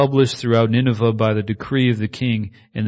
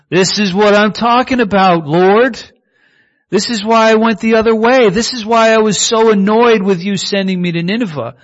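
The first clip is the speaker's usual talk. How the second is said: higher, louder complaining to the Lord; annoyed Jonah; 9 semitones higher, 5 dB louder than usual. Acted. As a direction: whine your grievance at God.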